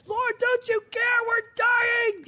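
high-pitched, hammed-up desperate shout; panicked yell. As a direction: yell to be heard over the storm. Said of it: "he yells that".

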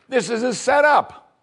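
high, drawn-out alarm. Retold or imitated. King of Israel suspecting a trap, high alarmed. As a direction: cry it out as a panicked king.

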